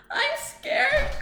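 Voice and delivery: very high, sobbing and scared; frightened robot sobbing; scared sob. A narrator's reading. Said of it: "Clunk sobbed".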